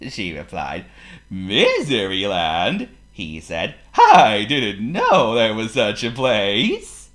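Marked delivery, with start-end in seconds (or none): 1.3-2.9 loud, drawn-out questioning with rising end; jolly character's incredulous question; Mister Happy. Acted. 3.9-7.1 loud, slow, swooping surprise; jolly character voice, higher and marvelling; Mister Happy. Acted.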